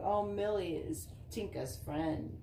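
usual speaking voice

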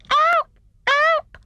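very high, loud little squeal; tiny indignant shriek. A narrator's reading. Kicked hedgehog calls from inside snowball, indignant shriek. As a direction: shriek like a tiny trapped creature. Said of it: "shrieked the tiny faraway voice indignantly".

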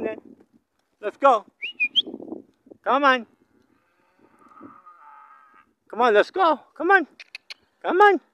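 loud, high calls across a field; calling out to cattle. Real, not acted. Rancher calling out to the herd, loud and high. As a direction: holler to get the cows moving.

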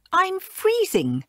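high, slow whiny complaint; petulant. Acted. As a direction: whine it like a spoiled girl. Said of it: "came her petulant voice".

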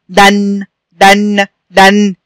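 short a in دن, then hum the n; fatha tanween. Shown as a demonstration. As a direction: say دن as "dan" with a held n. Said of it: "الدال بتتنطق مع إزاي لوحدها وهي منونة". (نطق دن "دَنْ" بنون ساكنة)

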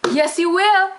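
put-on baby talk. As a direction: coo it like talking to an infant. Talking to viewer like a baby.

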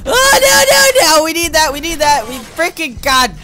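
high, loud panicked no-no-no; desperate squeal as the bomb slips away; panicked cry. Real, not acted. Gamer sees the needed bomb lost, panicking. Losing vital item in game.

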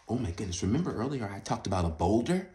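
ordinary delivery, nothing marked

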